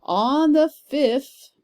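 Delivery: "On the fifth," slow, high, with a pause after "fifth"; scanning the meter. Shown as a demonstration. Teacher showing students where the stress falls, slow and deliberate. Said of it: "let me show you here".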